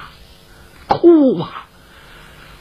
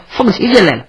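plain reading voice

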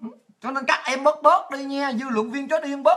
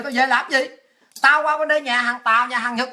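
ordinary talk, nothing marked

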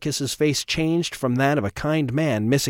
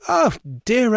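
high, louder, theatrical dismay; fussy gentleman; 10 semitones higher, 4 dB louder than usual. Acted. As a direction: exclaim in fussy mock alarm. Trevor's entrance, high voice.

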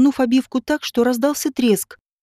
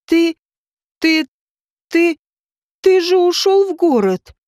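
high, halting stammer in shock; stunned babbling; 5 semitones higher, 4 dB louder than usual. A narrator's reading. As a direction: stammer it out, pitched up, each start breaking off. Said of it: "залепетала Женька".

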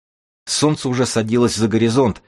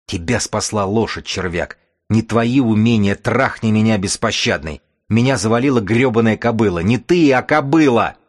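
furious outburst; 3 semitones higher, 3 dB louder than usual. Acted. Captive chief screams that a mare beat him, building fury. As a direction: rage rising to a shout. Chief rages about the horse.

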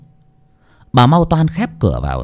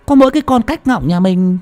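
put-on puzzled old woman; 9 semitones higher than usual. A narrator's reading. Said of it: "ngơ ngơ ngác ngác".

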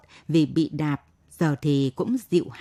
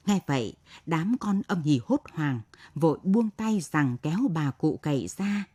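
ordinary delivery, nothing marked

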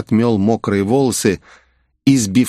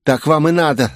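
higher-pitched vindictive mutterer's voice; malicious, raised-pitch jeer; spiteful hiss; 7 semitones higher than usual. A narrator's reading. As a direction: spit it after them spitefully. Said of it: "злобно прошипел спичка".

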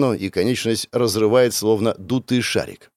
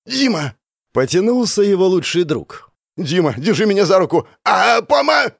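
high frantic yell; desperate shouting; 8 semitones higher than usual. Acted. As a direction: scream for your friend. Friend desperately reaching out.

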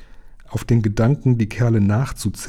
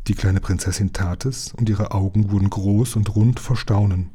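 plain reading voice

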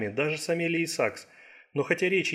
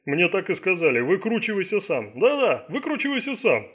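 high, louder mock quoting; scornful; 5 semitones higher, 4 dB louder than usual. A narrator's reading. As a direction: scornfully parrot the bosses' brush-off. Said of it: "презрительно сплюнул долговязый агент".

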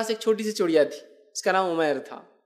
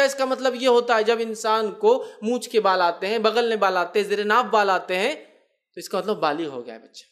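ordinary delivery

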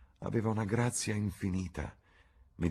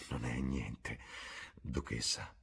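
murmured; 7 semitones lower, 8 dB quieter, slower than usual. A narrator's reading. Dorian reassuring the duchess, low murmur. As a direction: lower and softer, murmured. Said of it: "mormorò".

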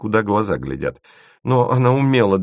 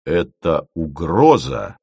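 sullen, bristling; 4 dB louder, much slower than usual. A narrator's reading. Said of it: "набычившись".

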